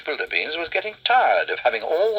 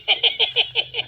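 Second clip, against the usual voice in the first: witch cackle. Acted.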